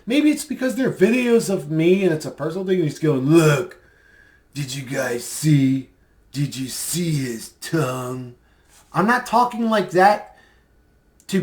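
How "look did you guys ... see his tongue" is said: slow, drawn-out creepy imitation of the guy; impersonating Steven. Vlogger mocking a creepy streamer's voice, slow and eerie. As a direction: mimic his slow, eerie way of talking. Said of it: "trying to, uh, replicate his voice".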